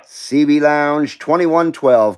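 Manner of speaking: CB radio call. Real, not acted. Calling out on CB radio.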